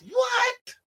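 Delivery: high, sudden mock-shocked yelp; scandalized high "what"; incredulous outburst. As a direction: cut in with disbelief, pitch jumping up. Cuts off an acted excuse, incredulous.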